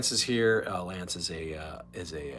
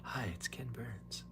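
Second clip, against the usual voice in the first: Ken Burns whisper; 13 dB quieter, slower than usual. Retold or imitated. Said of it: "Mister Documentary Guy, Ken Burns".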